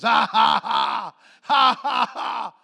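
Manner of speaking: loud, defiant mock laugh; Brother Hagin laughing. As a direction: shout out forced ha-has in the devil's face. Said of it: "And he laughed a little while longer".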